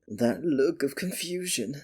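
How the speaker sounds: usual speaking voice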